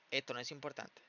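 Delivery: normal talking voice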